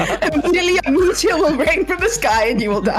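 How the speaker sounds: mock doom. GM mock divine threat.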